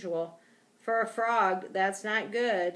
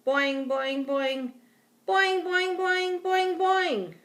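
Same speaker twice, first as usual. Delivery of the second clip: high, loud, springy croaks climbing higher; frog boings; 10 semitones higher, 5 dB louder, slower than usual. A narrator's reading. Frog raises the alarm for trapped friend, frantic boinging. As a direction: build to frantic, rapid-fire boings. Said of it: "what was that I heard?".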